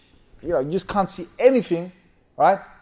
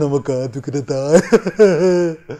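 drawn-out mock fearful wail; mock panic; 4 dB louder, slower than usual. Storyteller mocking his scared companions. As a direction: mimic the panicky passengers. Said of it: "bringing the emotions in and fear".

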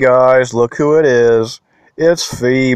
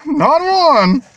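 high squealing NOT ONE, drawn out; excited squeal; 4 dB louder than usual. Acted. Collector teasing his viewers, high excited squeal. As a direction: squeal it like a giddy fan.

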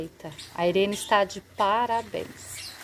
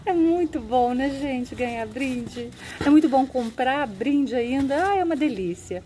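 high, loud delighted gushing; thrilled high praise of the gifts; excited gush; 5 semitones higher, 4 dB louder than usual. Real, not acted. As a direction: squeal about the freebies. Gushing about free gifts.

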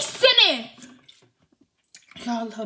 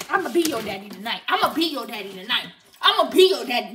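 low, dragged threat; mock menacing; 9 semitones lower than usual. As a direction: play the menacing dad.

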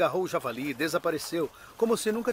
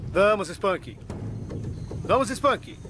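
calling out; 5 semitones higher, much slower than usual. Real, not acted. Calling the alligator to strike.

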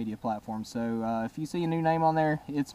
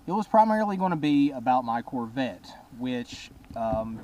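ordinary talk, nothing marked

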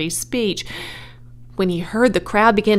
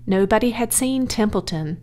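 plain reading voice

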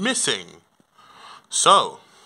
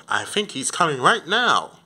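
ordinary talk, nothing marked

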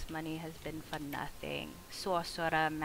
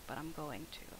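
unvoiced, sneaky whisper; 3 semitones lower, 10 dB quieter than usual. Real, not acted. Streamer whispering a sly plan to viewers, hushed. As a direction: whisper it as if plotting a trick. Whispered aside while playing.

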